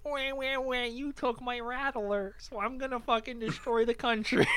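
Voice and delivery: mock toddler. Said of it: "him being a toddler going like".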